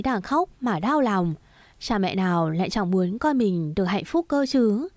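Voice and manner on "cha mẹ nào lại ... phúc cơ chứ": ordinary delivery, nothing marked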